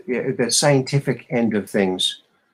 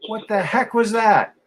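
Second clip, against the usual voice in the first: high, puzzled what the heck; baffled; 9 semitones higher than usual. Real, not acted. Reacting to the mystery sound.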